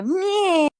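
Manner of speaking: high-pitched cartoon kid grumble; Eric Cartman meh. Shown as a demonstration. Showing her friend the one Cartman sound she can do. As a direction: squeak out a whiny cartoon meh. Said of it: "I can, I can do".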